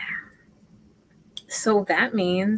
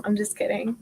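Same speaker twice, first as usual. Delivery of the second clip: normal talking voice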